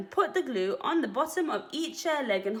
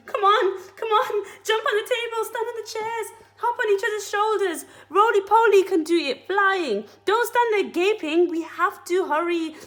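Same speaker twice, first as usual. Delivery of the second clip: high, loud, urgent monkey chief; frantic high shouting to the animals; frenzied excitement; 9 semitones higher, 5 dB louder than usual. A narrator's reading. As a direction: hurry everyone in a frantic frenzy. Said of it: "He was in a frenzy of excitement now".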